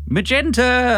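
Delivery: high, loud calling cry; distant shout. Acted. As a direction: holler the name through the house. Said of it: "I hear Bernard shout in the distance".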